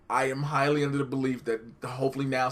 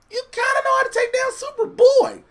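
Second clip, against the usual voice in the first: very high, louder sarcastic squeal; 7 dB louder than usual. Real, not acted. Co-host arguing Bruce can beat Superboy, high sarcastic jab. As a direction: push it way up high, dripping sarcasm on "kinda". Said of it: "I said that's sarcastic".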